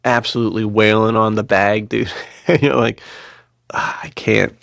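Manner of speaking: ordinary talk, nothing marked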